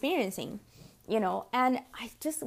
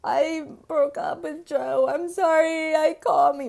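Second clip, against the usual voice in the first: slow, slurry imitation of a tipsy friend, higher and louder; drunk friend; 6 semitones higher, 5 dB louder, slower than usual. Friend imitating a drunk girl who just broke up, slow and slurred. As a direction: ham up a drunk girlfriend calling you late.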